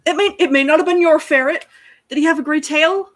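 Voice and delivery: panicked hope. Acted. Alphonse panics about ferret.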